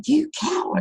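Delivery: put-on the echo answering the boy. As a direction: let it bounce back fainter. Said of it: "And came back".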